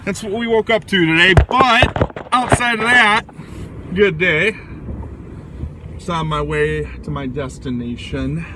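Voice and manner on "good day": usual speaking voice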